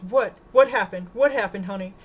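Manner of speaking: frantic mother, voice up; alarmed cry. A narrator's reading. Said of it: "grabs Marilyn's shoulders and cries".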